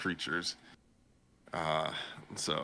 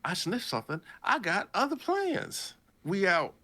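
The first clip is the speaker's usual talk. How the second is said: sass it like a bear with plans; sassy bear voice; 12 semitones higher than usual. Retold or imitated. The bear blowing off the man, high and cheeky. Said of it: "the bear's like".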